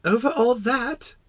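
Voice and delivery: put-on high, slow child-like question; wondering little prince. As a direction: ask in a small high awed voice. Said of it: "asked the little prince".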